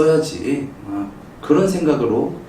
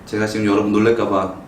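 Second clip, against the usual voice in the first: usual speaking voice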